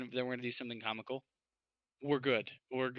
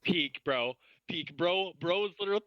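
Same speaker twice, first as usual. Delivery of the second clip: ordinary delivery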